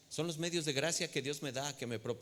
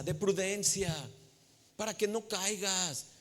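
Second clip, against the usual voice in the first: raised, impassioned pulpit voice, voicing Christ's plea; preaching at full force; 7 semitones higher than usual. Preacher voicing the Lord, urging the congregation to abide, impassioned. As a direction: plead as the Lord calling his people.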